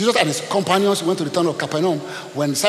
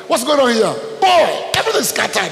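loud, high startled cry; shocked townsfolk; 7 semitones higher, 5 dB louder than usual. Retold or imitated. Townspeople running out to see the pigs, shouting in alarm. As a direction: shout out in alarm at the scattered herd.

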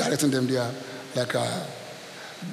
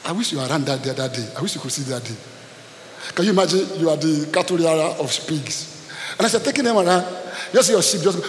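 ordinary talk

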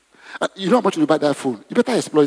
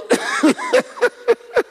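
long high-pitched laugh; real, helpless high giggles; giggling fit. Real, not acted. Preacher imitating phone users, giggling helplessly. Cracks up again.

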